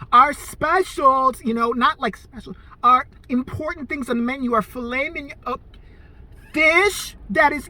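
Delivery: exaggeratedly slow, overarticulated speech; talking down slowly. Waitress mocking a slow customer, drawn-out condescending pace. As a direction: spell it out as if to a slow child. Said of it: "She did one of these numbers".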